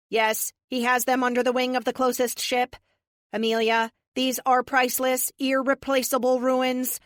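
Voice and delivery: crisp synthetic AI voice. A narrator's reading. Epic the AI voice.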